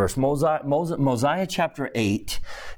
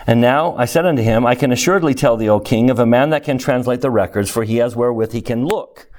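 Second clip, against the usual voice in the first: louder proclaiming read; scripture reading; 3 semitones lower, 7 dB louder than usual. Acted.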